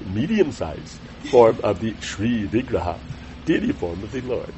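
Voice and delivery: put-on Calcutta-style Indian English; Indian English accent. Shown as a demonstration. Teacher reading scripture as learned in Calcutta, Indian English accent. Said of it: "I picked, I learned this in Calcutta".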